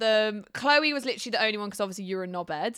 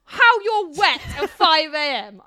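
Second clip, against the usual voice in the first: exasperated producer; 8 semitones higher, 6 dB louder than usual. Retold or imitated. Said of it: "She's like".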